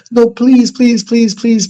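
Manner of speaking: high, loud mock pleading; begging neighbor. Neighbor begging to see the baby, high whiny pleading. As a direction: beg like a kid who won't quit. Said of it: "she says".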